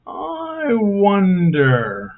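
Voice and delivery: slow, high, drawn-out musing; theatrical wondering. Acted. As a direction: stretch it out like a puzzled sigh.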